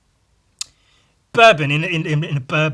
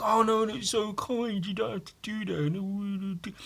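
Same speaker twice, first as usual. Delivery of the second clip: higher, softer fawning voice; mock polite protest; 5 semitones higher, 5 dB quieter than usual. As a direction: play the bashful friend who can't accept. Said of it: "he was like".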